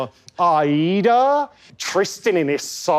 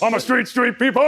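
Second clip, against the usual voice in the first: [name] impression; 5 semitones higher, much faster than usual. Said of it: "[name]'s doing D M X again".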